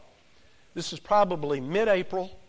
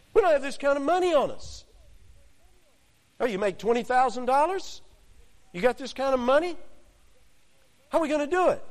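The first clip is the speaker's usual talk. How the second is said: sarcastic disciples; 7 semitones higher, faster than usual. Retold or imitated. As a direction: throw the objection back, mocking. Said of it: "smart alecks".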